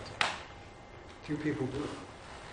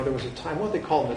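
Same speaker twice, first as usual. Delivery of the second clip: normal talking voice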